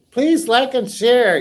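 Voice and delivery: please like and share, loud and drawn out. Real, not acted. Viewer plea for likes, loud and slow. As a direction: call it out to viewers, slowly and loud. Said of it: "Please like, share".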